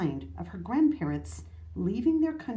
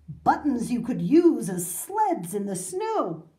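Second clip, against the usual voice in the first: grand boasting; 8 semitones higher, 6 dB louder than usual. A narrator's reading. Grandfather bragging about his buttons, loud awed delight. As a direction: marvel grandly, stretching out each wonder.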